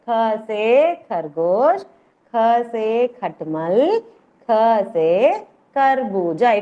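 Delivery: slow, stretched syllables for the class to follow; slow drill. Shown as a demonstration. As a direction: recite each letter and word very deliberately. Teacher repeats letter-word drill.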